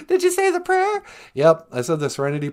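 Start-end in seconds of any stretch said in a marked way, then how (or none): 0.1-1.0 chirpy robot sidekick offering a ride home; high, squeaky sidekick voice. Pretend. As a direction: squeak it like a tiny robot pal. Voicing the companion.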